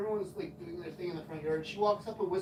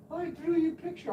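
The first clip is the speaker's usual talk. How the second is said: put-on high childlike voice; 12 semitones higher, 4 dB louder than usual. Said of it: "she's like".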